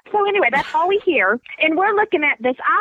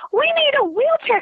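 panicked plea; 12 semitones higher than usual. Retold or imitated. Panicking in the store.

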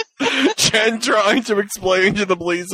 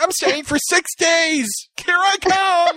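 mock excited; 12 semitones higher than usual. Friend announcing trip, imitated.

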